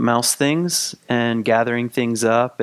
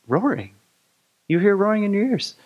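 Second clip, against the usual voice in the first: mildly surprised, higher-pitched echo; incredulous question; 8 semitones higher than usual. Acted. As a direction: repeat it back in rising disbelief. Raccoon questions mouse's odd claim.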